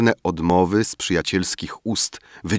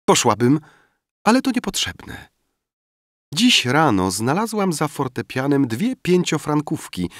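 put-on high, louder, quick girlish voice; eager young girl; 9 semitones higher, 3 dB louder than usual. A narrator's reading. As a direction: bubble over with good news. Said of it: "rzekła".

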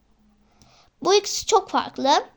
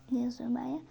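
real whisper to herself; whispered aside; 3 semitones lower, 12 dB quieter, much faster than usual. Real, not acted. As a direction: whisper it as a self-correction. Muttering at own writing mistake.

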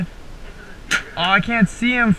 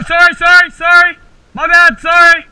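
calling out; 7 semitones higher, 5 dB louder than usual. Real, not acted. Apologizing to ref after hitting him.

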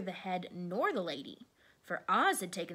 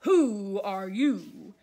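very loud, drawn-out challenge; great slow roar of a question; roaring beast; 10 dB louder, much slower than usual. A narrator's reading. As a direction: boom it out slowly at the intruder. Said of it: "in a voice that was like one great roar".

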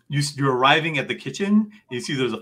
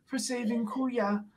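put-on much higher, sleepy old lady voice, paybor for favor; tired grandma with accent; 10 semitones higher than usual. Lola thanking the party before going to sleep, high and tired. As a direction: mumble thanks like an exhausted grandmother. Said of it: "Lola is sitting there going".

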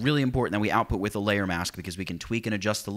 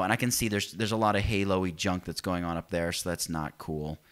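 ordinary talk

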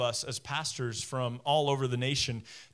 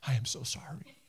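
breathy whispered apology, voice near gone; tearful whisper; 5 semitones lower, 5 dB quieter than usual. Retold or imitated. Wife on the couch apologizing to a struggling man, whispering through tears. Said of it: "crying and going".

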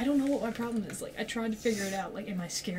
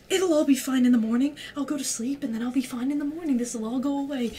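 panicky self-reassurance; 5 semitones higher, 6 dB louder than usual. Retold or imitated.

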